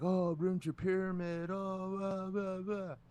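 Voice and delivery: high-pitched taunting imitation; mocking. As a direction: make fun of a beaten rival in a high voice. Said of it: "he was making fun of me".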